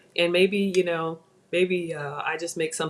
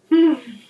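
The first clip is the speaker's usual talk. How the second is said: savoring hum; 6 semitones higher, 8 dB louder than usual. Real, not acted. Tasting bacon.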